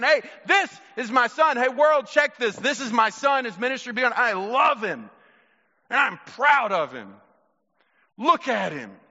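high, loud proclamation of pride; excited, proud father. Retold or imitated. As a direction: announce your son to the whole world. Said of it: "This is God's excitement in an audible way".

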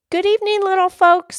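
high, loud greeting; high-pitched eager lass; little girl. Acted. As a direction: hail them in a high child's voice.

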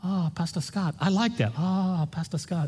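lightly voiced soft Thai accent; a hint of a gentle Thai greeting; Thai friend greeting. Pastor imitating Thai friends greeting him, soft light Thai accent. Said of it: "they'd always take their hands and go".

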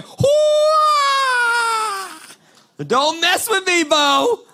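hammed-up kung fu shout; a rabbi playing fighter with a battle cry.

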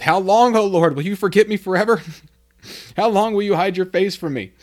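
mock anguished wail. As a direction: cry out to God like it's your worst day, half laughing. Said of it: "you can tell someone's having a bad day when they start off a prayer with".

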